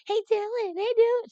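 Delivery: shrill sing-song mock of a call, very high and loud; mocking imitation. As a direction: mimic someone calling out, teasing. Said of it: "She's making fun of me".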